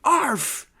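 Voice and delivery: high, loud bark. Acted. Masked invaders answering Mary Ann, barking it out. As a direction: bark it as one burst. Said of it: "barked the invaders".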